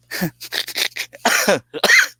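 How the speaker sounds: high breathless cackle; cackling laugh. Real, not acted. Streamer cracking himself up over his joke, high wheezy laugh.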